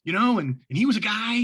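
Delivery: high, innocent joking tone; playful teasing. Retold or imitated. Referee teasing his nervous partner during a timeout, high innocent joking.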